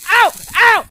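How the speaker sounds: high, loud hammed-up yelps of a burnt tongue; mock pain. Vlogger mimics burnt customer sipping scalding latte, loud high yelps. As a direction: yelp like the latte just scalded you.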